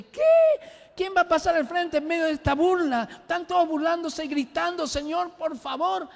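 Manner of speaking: high, loud, disbelieving outburst; incredulous protest. Retold or imitated. Preacher objecting to God amid a mocking crowd, incredulous protest. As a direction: protest in alarm, pitch way up. Acted protest to the Holy Spirit.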